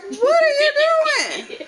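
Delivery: high, loud, alarmed cry of disbelief; a mother horrified at what she sees; shocked mom. Retold or imitated. Mom catches naked daughter outside.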